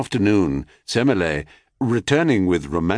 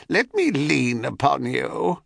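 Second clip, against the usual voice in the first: put-on old hag voice; 7 semitones higher than usual. A narrator's reading. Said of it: "said the hag".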